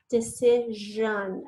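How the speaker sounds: decision drawn out slowly, syllable by syllable; teacher dictating slowly; slow dictation. Shown as a demonstration. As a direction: stretch out decision for spelling. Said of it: "is decision".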